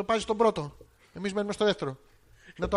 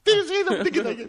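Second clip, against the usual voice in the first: high, loud hammed-up wail; mock tearful jealousy; 10 semitones higher, 5 dB louder than usual. Jealous wife accusing husband at home, mock tearful wail. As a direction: accuse him in fake hysterics. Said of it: "μην κλαις, μην κλαις".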